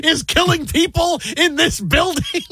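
high, slow disbelieving voice; mock incredulity. Host acting out reaction to [name] theory, high mock incredulity. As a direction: draw it out high in exaggerated disbelief.

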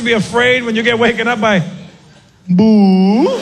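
put-on a mild boo in an Indian accent; a ghost saying boo politely, Indian accent. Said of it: "had an Indian accent".

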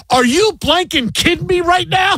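high, furious yelling at a yawning husband; angry wife. Retold or imitated. Wife in labor yells at husband for yawning, furious shout. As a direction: shout it, outraged at him. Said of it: "she goes".

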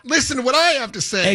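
high-pitched mock college kid; self-important student.

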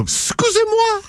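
an affronted squeal, played for laughs; mock indignation. Co-host objects to being talked over, mock indignant.

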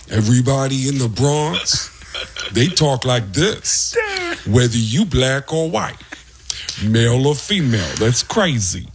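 slow, drawling Bronx Tracy Morgan voice; Tracy Morgan impression. Comedian imitating Tracy Morgan for the radio hosts. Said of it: "sounds like Tracy Morgan".

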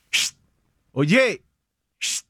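short hush, then oye called out; quick parental shush to get attention; quick scolding hush-and-oye. Shown as a demonstration. Dad quieting his kids, quick scold. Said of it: "It's the sound effect, oye".

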